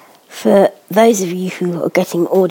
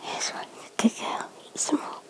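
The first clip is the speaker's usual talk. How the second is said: soft unvoiced coaxing whisper; 16 dB quieter than usual. Real, not acted. Mother urging baby to eat more, whispering. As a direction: coax her under your breath.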